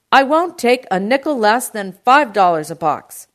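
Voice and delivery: loud, high, stubborn shout. A narrator's reading. Uncle haggles with produce man, shouting. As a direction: refuse at the top of your voice. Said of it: "my uncle shouted".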